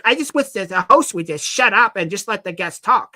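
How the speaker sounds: hint of a whiny commenter; mock commenter. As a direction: sneer the complaint. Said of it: "if you're like".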